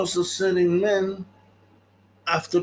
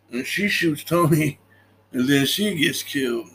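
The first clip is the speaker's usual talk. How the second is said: ordinary delivery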